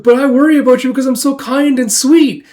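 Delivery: high, loud mock-sweet protest; overplayed pleading. Sister insisting she worries about her brother, overplayed pleading. Acted sister answers back.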